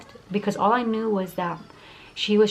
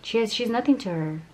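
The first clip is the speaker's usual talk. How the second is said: usual speaking voice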